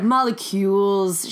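"Molecules" slowly drawn out and odd; weird narrator pronunciation. Retold or imitated. She goes like molecules, weirdly stretched imitation. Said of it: "She said it very weird".

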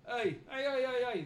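loud, high warning hails; calling out. Acted. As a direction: shout to stop someone. Poacher calls out to intruder.

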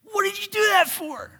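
high-pitched, exasperated demand; mock confrontation. Moses confronting Aaron over the calf, indignant. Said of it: "He's gonna get right in his face".

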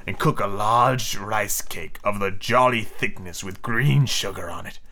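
slow, low villain scheming; drawn-out pirate captain; slowly through teeth. Acted. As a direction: savor the plan word by word. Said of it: "Hook replied slowly through his teeth".

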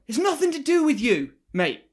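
high, loud yell back; a retold yell, high and loud. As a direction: shout it across the road at him. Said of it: "And I just went".

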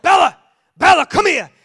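high, very loud yell across the park; shouting my head off. Retold or imitated. Owner shouting for dog that won't return, full yell. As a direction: scream her name to get the dog back. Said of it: "I'm shouting my head off".

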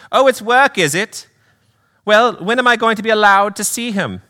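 high, indignant British lady; Pam. Acted. Mother demanding to see her son, high British accent. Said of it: "do a little accent work".

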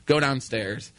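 ordinary delivery

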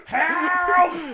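high, drawn-out comic cry for help; mock scream for help. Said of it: "he wasn't even screaming yet".

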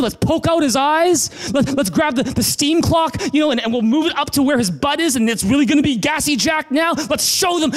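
high, worked-up revenge rant; mock vengeful rant. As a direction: ham up an angry mob plan.

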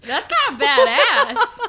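laughing. Real, not acted. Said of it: "It wasn't badass".

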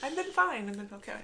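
high, softening reassurance; unsettlingly upbeat. Real, not acted. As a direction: start high, drop to small and quiet. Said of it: "that was, like, scary".

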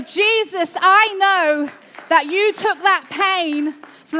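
the pastor demonstrates praying through the pain; fervent prayer. Shown as a demonstration. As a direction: pray it out loud with full fervour. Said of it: "I'm gonna go".

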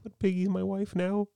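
Kermit. Acted. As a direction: stay in the Muppet frog voice, slowly. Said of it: "Do your Kermit".

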